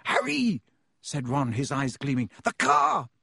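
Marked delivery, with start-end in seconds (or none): 0.1-0.6 high, sudden excited call; excited exclamation. A narrator's reading. Boy gets his friend's attention with an idea, excited. 2.4-3.1 high, loud burst of excitement; excited exclamation. A narrator's reading. Boy with eyes gleaming remembers the car, excited. Boy reveals his sudden idea.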